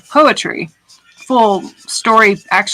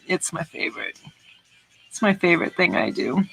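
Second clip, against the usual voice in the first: quiet; 9 dB quieter than usual. Real, not acted. Soft emphasis on loving art.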